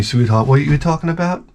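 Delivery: ordinary talk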